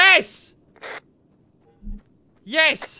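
loud, high joyful yell; triumphant cheer. Real, not acted. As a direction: cheer the lucky find. Supply crate found.

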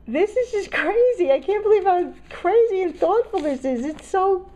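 high, loud, gushing disbelief; real, thrilled amazement; overwhelmed excitement. Real, not acted. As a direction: gush at a high pitch, overwhelmed. Overwhelmed by thoughtful gift.

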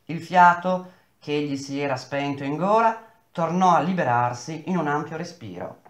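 ordinary delivery, nothing marked

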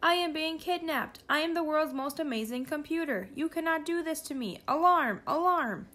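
high-pitched computer in alarm; mechanical voice. A narrator's reading. Kidnapped computer protests from the backpack, mechanical voice. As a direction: protest as a high, panicky machine. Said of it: "came the mechanical voice from the backpack".

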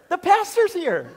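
high, laughing, thrilled news; bursting with delight; excited announcement. Retold or imitated. Jim waking his wife, laughing with excitement. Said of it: "shakes Karen so gently".

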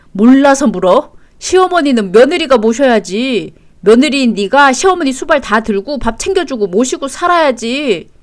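loud, hectoring tirade; angry demand. Acted. Husband ordering wife to serve his mother, angry. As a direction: lay down the law forcefully.

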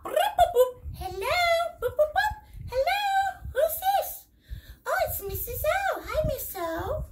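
high phone beeps and hello; pretend phone. Parent pretending to take a phone call for the kids, high voice. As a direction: chirp the ring then answer brightly.

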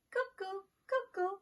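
high falling cuckoo; high cuckoo clock call. Acted.

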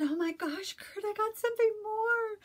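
high, thrilled call to her husband; retelling telling her husband about the package, high and thrilled; excited exclaim. Retold or imitated. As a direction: squeal the news to your husband.

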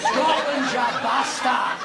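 very high, strained battle cry; defiant yell. Acted. Trapped Scot defying the lift, high screaming war cry. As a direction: bellow it high, drawing out the end.

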